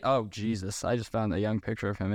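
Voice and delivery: ordinary delivery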